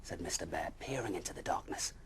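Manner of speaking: ordinary delivery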